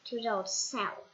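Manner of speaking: ordinary delivery, nothing marked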